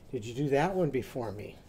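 normal talking voice

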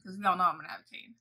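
ordinary talk

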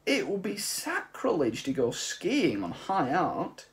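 high, loud, slow and impassioned; indignant old artist. Acted. Old man defending his glaciers to the mice, high and impassioned. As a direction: plead for the glaciers as high art.

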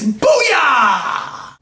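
high, loud victory whoop; explosive celebratory holler; excited shout. Real, not acted. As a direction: yell it in triumph, dragging out the end. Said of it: "I've gotten excited during a video".

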